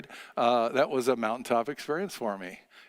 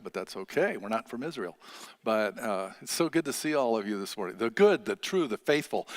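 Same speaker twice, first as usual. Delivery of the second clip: normal talking voice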